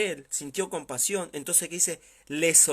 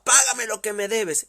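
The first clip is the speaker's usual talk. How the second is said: louder, higher furious demand; angry demand; 6 semitones higher, 7 dB louder than usual. Acted. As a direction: shout it, grabbing him by the throat. Repeated violent demand.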